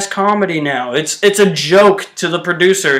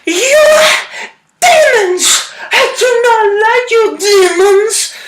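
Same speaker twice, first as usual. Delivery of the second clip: shrieky witch parody; slower than usual. Retold or imitated. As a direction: screech it like a cartoon villain witch. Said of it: "this is how she talks".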